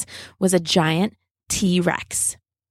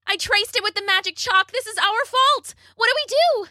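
high-pitched storybook panic; acted alarm, pitched way up; panicked; 14 semitones higher than usual. A narrator's reading. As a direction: blurt it out in a much higher voice. Bella panicking as T-Rex attacks.

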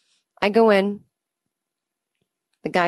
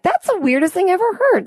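high, loud mock outrage; mock shock; 12 semitones higher, 5 dB louder than usual. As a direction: overdo the disbelief. Mocking shocked reaction.

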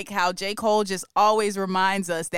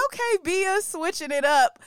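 surprised reaction; 10 semitones higher than usual. Retold or imitated. Reacting to surprise drill verse.

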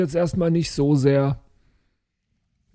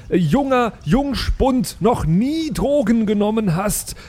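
higher-pitched character voice, chiding a drugged friend; scolding adventurer; 8 semitones higher than usual. Acted. Adventurer scolding the mushroom-addled companion, higher and exasperated. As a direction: lecture him like a fed-up comrade.

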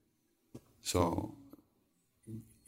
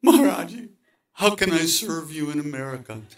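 much higher, louder burst of a question; blurted out; 9 semitones higher, 8 dB louder than usual. Retold or imitated. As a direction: blurt it out all at once. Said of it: "finally I blurted out".

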